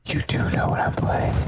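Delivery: hushed conspiratorial whisper. Real, not acted. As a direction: whisper it like a secret. Secretive aside to co-host.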